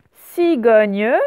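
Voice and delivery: CIGOGNE very loud, SI held at the start; teacher projecting CIGOGNE by syllables; split into syllables. Shown as a demonstration. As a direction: sound out CIGOGNE with its final E voiced. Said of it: "cette fois, c'est au tout début".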